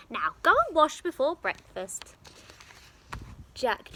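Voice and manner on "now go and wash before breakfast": bossy giantess. Acted.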